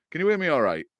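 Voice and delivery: quick Hull accent, "hear me" run into "'ear me"; broad Hull accent. Real, not acted. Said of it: "my whole accent is terrible".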